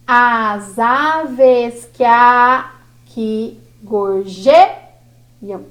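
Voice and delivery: very slow, syllables drawn apart; teacher scanning, slowly; slow scansion. Shown as a demonstration. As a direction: stretch out every syllable of "As aves que aqui gorjeiam". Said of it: "Por que nós paramos aqui".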